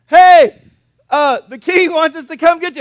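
loud, high holler up a hill; calling out. Acted. Captain shouting up to Elisha on the hillside, hollered summons. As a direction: hail someone far above you, acting it out.

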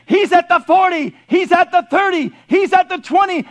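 high, loud play-by-play announcer; excited football commentator; Chris Berman call. Retold or imitated. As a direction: call the run like a TV sportscaster. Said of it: "you hear Chris Berman say".

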